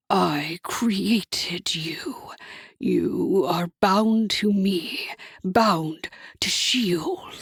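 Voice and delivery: low, very slow, whispered ownership; possessive devil whisper. A narrator's reading. Hasatan's voice.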